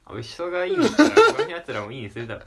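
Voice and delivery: laughing. Real, not acted. Laughing retort.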